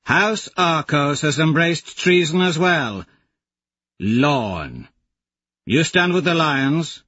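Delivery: a hint of a scornful warrior woman; calling out treason from below; lightly voiced Aja.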